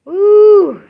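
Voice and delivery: high, loud drawn-out whoop; long woo. Real, not acted. Streamer letting out feeling after the story, high woo.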